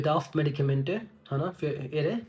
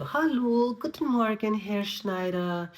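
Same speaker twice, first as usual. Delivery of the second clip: higher, caring greeting, slowing into the question; friendly nurse tone; 5 semitones higher, much slower than usual. Shown as a demonstration. Nurse greeting Herr Schneider in the morning, higher and friendly. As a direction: ask about his night like you truly care. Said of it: "tone का भी ध्यान दो".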